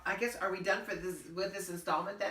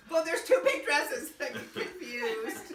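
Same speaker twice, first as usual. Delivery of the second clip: laughing through the words; 10 semitones higher, 8 dB louder, slower than usual. Real, not acted. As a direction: giggle while admitting the mix-up. Laughing at her own mistake.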